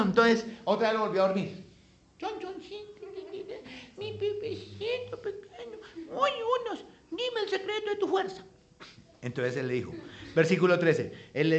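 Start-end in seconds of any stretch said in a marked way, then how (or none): 2.2-8.4 very high falsetto cooing; mock lovey-dovey squeaky voice; coaxing baby talk. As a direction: wheedle as if cuddling a baby. Dalila baby-talks Sansón for the secret.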